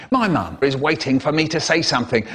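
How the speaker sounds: normal talking voice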